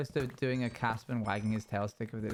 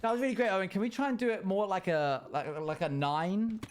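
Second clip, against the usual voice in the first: higher-pitched encouraging teacher; put-on teacher giving direction; drama teacher; 6 semitones higher than usual. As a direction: give a drama note in a raised teacher voice. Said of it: "I don't know, teacher goes".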